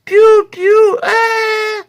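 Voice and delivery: squeaky high-pitched piou chirps; loud falsetto bird calls. Acted. Bird call imitation opening.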